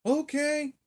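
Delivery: mom's high-pitched holler; high imitated call from afar; hollered back. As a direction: mimic Ma calling back, way up high. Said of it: "She hollers back".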